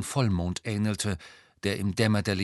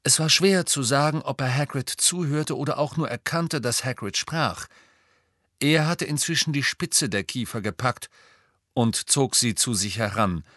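usual speaking voice

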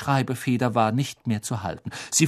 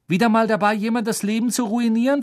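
raised, high-pitched accusation; loud, indignant question; loud challenge; 9 semitones higher, 3 dB louder, faster than usual. A narrator's reading. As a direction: call her out so others hear. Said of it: "fragte Harry laut".

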